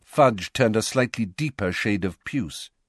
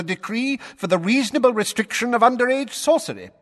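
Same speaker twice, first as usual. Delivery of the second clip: higher, louder official lecturing the court; pompous blustering minister; 12 semitones higher, 4 dB louder than usual. A narrator's reading. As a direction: lay down the rules like an irritated official. Fudge, pompous minister, pressing.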